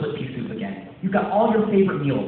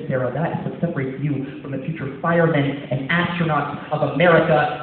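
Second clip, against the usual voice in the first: usual speaking voice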